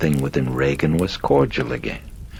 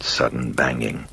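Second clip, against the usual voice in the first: plain reading voice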